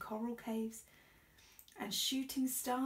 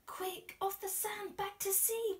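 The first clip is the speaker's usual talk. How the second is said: much higher, urgent snail cry; 10 semitones higher than usual. A narrator's reading. Snail urging the beached whale, high urgent cry. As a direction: hurry him back to sea. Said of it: "cried the snail".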